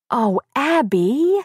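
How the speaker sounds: high, drawn-out sympathetic groan; dismayed sympathy. A narrator's reading. Friend hears tearful confession, dismayed sympathy. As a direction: stretch it out in pained sympathy.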